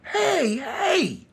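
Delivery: very high, thin mock holler; woman hollering. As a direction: imitate a woman's high-pitched screaming. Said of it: "she starts hollering".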